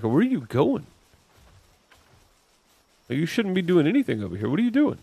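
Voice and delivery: rising alarmed questions; startled, chasing after it. Real, not acted. Pal wandering off, alarmed.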